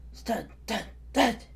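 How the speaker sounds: thumping footstep sounds, last one loud; stomping footsteps. A narrator's reading. Narrator sounds the big dinosaur coming, heavy stomps. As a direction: thump out the steps.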